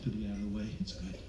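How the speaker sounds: low, very soft self-talk; talking to oneself. Acted. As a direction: mumble it privately, well under your voice. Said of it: "Talking to myself".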